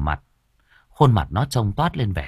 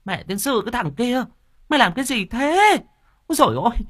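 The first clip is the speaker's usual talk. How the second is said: high shrill screeching scold, much higher; furious stepmother shriek; faster than usual. Acted. Stepmother screaming at the boy over her cat, furious high shriek.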